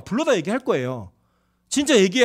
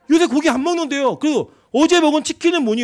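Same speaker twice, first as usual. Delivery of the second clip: higher, louder exasperated retelling; teasing disbelief, raised voice; mock exasperated quote; 7 semitones higher, 6 dB louder than usual. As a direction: play the nagging pastor, incredulous. Retelling teasing argument about meat.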